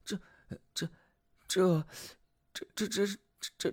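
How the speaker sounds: stammering in disbelief; can't get the words out; stunned stammer. Acted. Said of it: "士兵整个人傻了".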